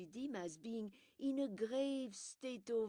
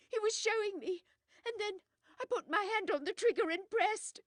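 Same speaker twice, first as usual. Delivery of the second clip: high, loud frantic pleading; hysterical confession; 13 semitones higher, 11 dB louder than usual. Acted. Widow confessing to Poirot and Hastings, hysterical high pleading. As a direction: confess in panic, begging to be saved.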